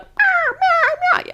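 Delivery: very high squealing mock meows; seagull cries. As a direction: squeal them out like a pesky seagull. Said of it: "They'd be like".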